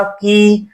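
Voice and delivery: "कि" with the i held for two beats; doubled vowel length. Shown as a demonstration. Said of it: "इसका दो गुना कहा". ("कि" की ई दो गुना लंबी)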